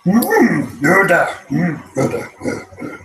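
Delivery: lightly voiced Yoda, breathy; a hint of the wise little Jedi. Streamer trying Yoda for the chat. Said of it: "I can do Yoda in a way".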